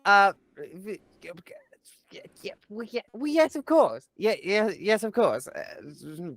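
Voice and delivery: high, startled, stumbling agreement; flustered stammer. Acted. Startled Fiacra agreeing to follow the captain, flustered. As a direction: stammer out a squeaky, flustered yes.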